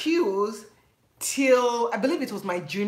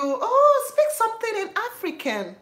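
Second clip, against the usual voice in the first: very high, eager request; imitating American classmate; 13 semitones higher than usual. Classmate asking her to speak African, very high and eager. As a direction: beg for it like a curious classmate. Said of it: "And I'm like "Thank you".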